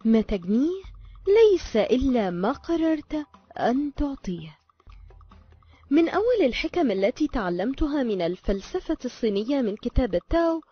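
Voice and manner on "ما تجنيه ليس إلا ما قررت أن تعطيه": slow, hushed whisper; a secret whispered to the listener; whispered maxim. Acted.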